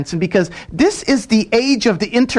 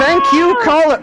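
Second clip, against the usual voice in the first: raised voice over the caller; louder, higher repeat; 7 semitones higher than usual. Real, not acted. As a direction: say it again louder. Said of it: "repeated over the caller".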